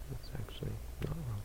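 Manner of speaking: whispered aside, barely voiced. Real, not acted. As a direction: drop to a soft whisper to reassure. Aside to the meditator.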